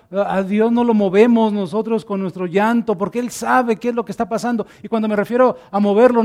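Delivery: usual speaking voice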